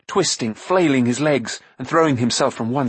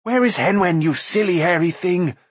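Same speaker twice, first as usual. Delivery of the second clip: bursting out; 7 semitones higher than usual. A narrator's reading. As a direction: explode at the creature. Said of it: "he burst out".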